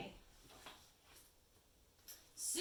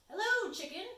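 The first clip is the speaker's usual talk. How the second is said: mouse voice; 9 semitones higher, 6 dB louder than usual. Acted. Mouse greets chicken.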